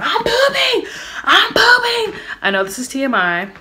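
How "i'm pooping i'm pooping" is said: very high, loud panicked cry; reenacted scream. Woman in labor screaming out to the room, re-enacted shriek. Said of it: "I start screaming out".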